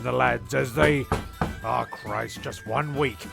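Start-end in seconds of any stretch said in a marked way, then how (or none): none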